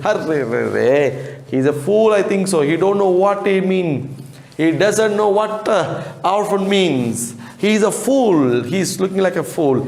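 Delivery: a hint of a mocking committee man; dismissive gentleman. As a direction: committee man scoffing at the boy. Voicing the man in the chair.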